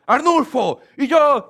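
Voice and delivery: loud, high-pitched yell at someone; shouting. Acted. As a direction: bellow the name as an angry scolding demonstration. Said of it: "si usted lo llega gritando". (gritando a todo pulmón)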